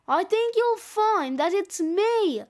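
loudly correcting; mild shout. A narrator's reading. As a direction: half-shout it over the rivals. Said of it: "shouts a third".

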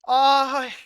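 sudden loud yelp; startled cry. Real, not acted. Manager yelps in surprise when the lineup won't change. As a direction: jump at the glitch.

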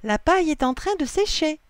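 high, loud, delighted; joyful cry. A narrator's reading. Lily sees the straw drying, joyful cry. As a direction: share the good news, thrilled. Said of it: "s'écrie-t-elle joyeusement".

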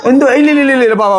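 high, repeated cooing reassurance. Real, not acted. Uncle comforting the child before picking him up, soothing baby talk.

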